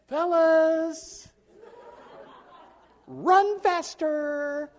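high, drawn-out hanky-waving holler; mock dainty call. Shown as a demonstration. Coach waving a hanky at players, mock dainty call. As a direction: sing out the order in slow, high, ineffectual politeness. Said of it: "you can't wave a hanky at 'em".

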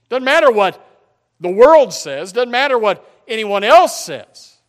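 loud, high preaching shout; fervent preaching. Acted. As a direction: drive it home at full volume. Preaching at full force.

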